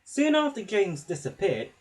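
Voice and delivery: ordinary delivery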